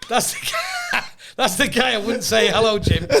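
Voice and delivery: high, breaking laughing words; helpless laughter. Real, not acted. Comic pointing out the man in the photo, helpless laughter. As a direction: struggle to speak through laughter.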